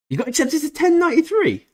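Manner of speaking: squeaky disbelief at the news; surprised squeal. Real, not acted. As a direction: shoot up high in astonishment. Surprised reaction to chat news.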